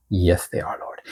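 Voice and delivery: ordinary delivery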